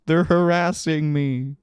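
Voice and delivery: high, plaintive fake complaint; mock whiny victim. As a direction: whine it like a put-upon victim. Plays company complaining of harassment.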